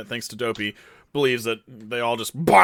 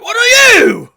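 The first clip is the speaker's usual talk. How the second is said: loud, high incredulous shout; high shocked yell; shouted question; 14 dB louder, slower than usual. Acted. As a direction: bellow it in disbelief. GM character shouting at player.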